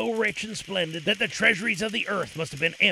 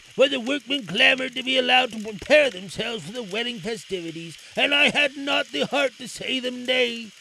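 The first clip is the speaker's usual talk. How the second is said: Aladdin voice; 6 semitones higher, 4 dB louder than usual. A narrator's reading. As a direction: address the king reverently.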